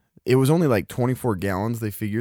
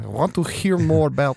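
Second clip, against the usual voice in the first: put-on Russian accent; 5 semitones higher than usual. Russian accent bit continues.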